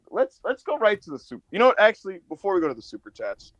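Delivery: ordinary delivery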